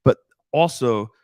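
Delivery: ordinary talk, nothing marked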